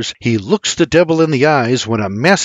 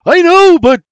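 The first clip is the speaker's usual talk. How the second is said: high, loud cut-off reply; full-voice urgent yell; shouting; 8 dB louder than usual. Acted. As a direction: shout back over the fire, then break off. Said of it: "he shouts".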